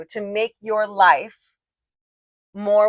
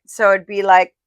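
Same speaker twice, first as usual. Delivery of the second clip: ordinary delivery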